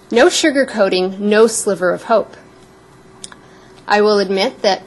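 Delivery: normal talking voice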